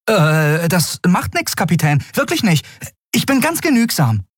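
put-on very high, keen boy voice; high-voiced young lad; eager boy. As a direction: reassure the captain eagerly, pitched right up. Boy eagerly accepts poor quarters.

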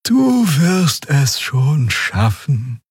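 very slow, high, lazy drawl; drowsy, drawn-out encouragement; sluggish. A narrator's reading. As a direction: drag it out languidly, pitched high. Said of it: "sagte Xia träge".

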